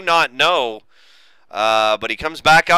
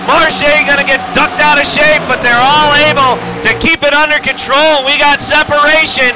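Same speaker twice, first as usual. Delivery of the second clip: loud-and-clear, higher-pitched race call; live race play-by-play; 8 semitones higher, 5 dB louder than usual. Real, not acted.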